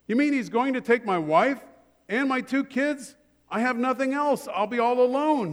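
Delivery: high-pitched acted dismay; pitched well up, pleading protest; alarmed disbelief. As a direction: play a man aghast at losing his family. Man losing family, acted dialogue.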